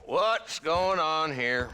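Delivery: loud, high, slow demand; commanding. Acted. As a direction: stop the standoff with a loud, slow challenge. Marshal breaks up standoff.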